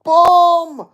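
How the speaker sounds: high, loud shout of boom; excited yell. Real, not acted. Fan cheering a clean knockdown, loud excited shout. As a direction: bellow it as the punch lands.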